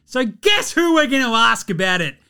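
high, loud outraged rant; ranting shout. Real, not acted. Host ranting at David Kahn for passing on Curry, loud outraged rant. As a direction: let the outrage spill over.

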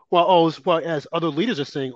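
ordinary talk, nothing marked

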